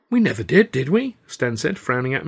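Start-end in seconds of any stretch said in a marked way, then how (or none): 0.1-1.2 confused protest. A narrator's reading. Stan confused, frowning.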